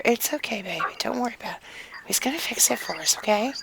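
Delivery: calm her down gently and quietly; soft soothing. Acted. Husband soothing crying wife.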